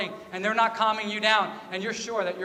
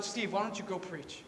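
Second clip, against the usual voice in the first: lightly voiced God, softer than usual; softly voiced God; 9 dB quieter than usual. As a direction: give God a quiet, gentle prompting. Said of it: "and God said".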